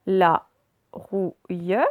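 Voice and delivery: "la rouille" broken into la, rou, ille; syllable by syllable. Shown as a demonstration. Teacher reading words aloud to pupils, syllable by syllable. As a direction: sound out "la rouille" with clear gaps. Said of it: "on va faire les deux d'un coup".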